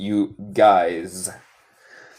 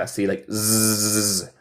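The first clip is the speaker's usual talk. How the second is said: slow "zzz" like a bee; a drawn-out bee buzz "zzz"; 5 dB quieter, slower than usual. Shown as a demonstration. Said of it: "Suena como una abeja".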